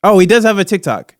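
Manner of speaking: ordinary talk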